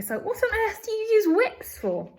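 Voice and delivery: high, loud bratty disbelief; incredulous cry. Acted. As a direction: demand it, baffled. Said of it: "cried Veruca".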